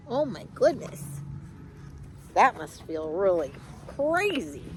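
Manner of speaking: usual speaking voice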